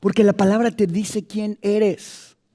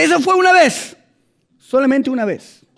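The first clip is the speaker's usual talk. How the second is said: defensive excuse; 6 semitones higher, 4 dB louder than usual. Acted. Pecador se justifica ante el portero.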